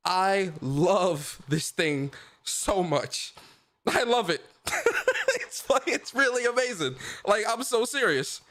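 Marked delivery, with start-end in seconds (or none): 4.7-5.4 giggle. Real, not acted. High laugh.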